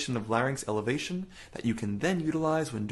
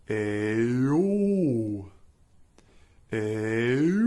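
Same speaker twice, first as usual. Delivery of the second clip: ayo sliding up in pitch, dark; dark dopey voice; 4 dB louder than usual. Shown as a demonstration. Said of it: "making it as dark and dopey as possible".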